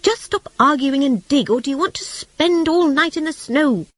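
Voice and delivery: high, urgent rat voice; impatient rat. A narrator's reading.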